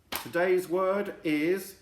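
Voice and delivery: drawn-out announcement; slow announcement. Acted. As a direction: build it up like a big reveal. Announcing word.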